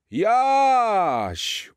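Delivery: high, loud happy call; glad greeting. Acted. Santa happily greeting Jaś on the roof, high and loud. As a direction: greet the boy with joy. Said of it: "ucieszył się święty".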